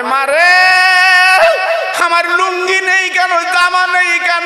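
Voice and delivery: a son crying to his mother about his lost clothes; son's anguished cry. Acted. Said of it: "বলে".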